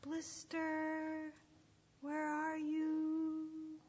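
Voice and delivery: long, slow drawn-out searching call; high, stretched vowels, distant shepherd; faint, far-away call. Acted. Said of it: "There it was again".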